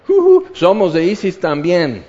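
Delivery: loud, high whoop of false allegiance; mock cheering. Soldiers greeting the invaders they fear, mock cheering. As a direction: cheer as if you were always on their side.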